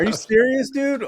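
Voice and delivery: high, loud, incredulous cry; can't believe what he just heard; disbelief. Real, not acted. Guest admits he never saw the movie, loud disbelief.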